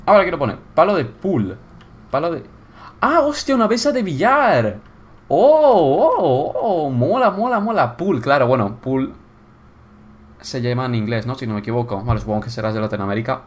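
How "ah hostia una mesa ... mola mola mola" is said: high, swooping excited exclamations; light up on finding a treat; delighted discovery. Real, not acted. Player finding the billiard table, high thrilled delight.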